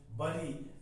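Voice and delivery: ordinary delivery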